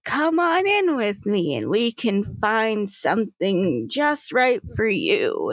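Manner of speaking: slow, drawn-out customer greeting; put-on shopkeeper welcome. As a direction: welcome customers in, stretching the words out.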